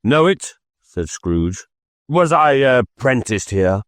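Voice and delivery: put-on Scrooge recognizing his old workplace; surprised Scrooge. A narrator's reading.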